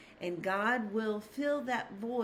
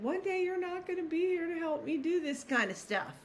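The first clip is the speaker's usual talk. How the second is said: retold tearful plea; 7 semitones higher than usual. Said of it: "and I just started crying".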